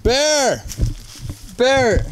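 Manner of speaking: high drawn-out call for a missing person. Acted. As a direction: call his name out into the woods. Searching for missing man, calling out.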